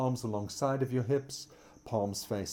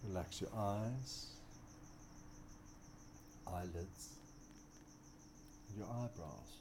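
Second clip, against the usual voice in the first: soft spa whisper; 10 dB quieter than usual. Acted. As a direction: ease them into stillness, growing softer. Guided relaxation in corpse pose.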